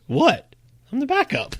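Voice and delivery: high, feigned innocent shrug; mock innocence. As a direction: play dumb, he's only the backup. Voicing goalie's mock-innocent comeback.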